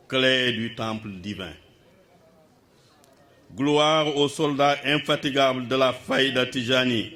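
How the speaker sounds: projected, ceremonial verse reading with measured pauses; poem declamation. Acted. Reader giving the poem's French translation to the gathering, ceremonial declamation. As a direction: declaim each phrase as solemn praise.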